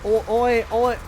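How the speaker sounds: a hint of a mock British lout; sloppy British lout calling out oi; lightly hammed-up British oi. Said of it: "what do they call women in England".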